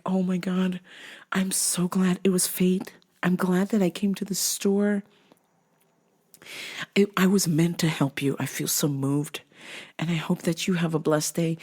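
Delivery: soft, gushing mock tenderness; moved sincerity. Side pony girl feels moved to help, soft mock sincerity. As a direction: speak softly, as if deeply touched. Voices grateful, moved do-gooder.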